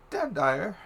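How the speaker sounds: high-pitched character voice, making a request; Menthor. Acted. Game master voices Menthor addressing Hunter.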